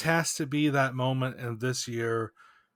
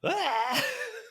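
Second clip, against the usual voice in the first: long high wailing sigh of despair. Real, not acted. Podcast host mourning his team's loss, pained sigh.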